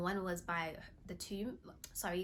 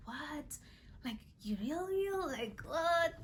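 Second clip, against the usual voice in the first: imitating disbelief; 8 semitones higher, slower than usual. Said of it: "you're just like".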